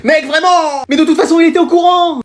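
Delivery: high, loud protest; exasperated squabble. Acted. Pupils confessing to the headmaster, exasperated self-defence. Élèves se reprochent l'aveu.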